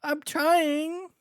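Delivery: high pleading captive; whiny bandit voice. Acted. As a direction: plead like a caught thief.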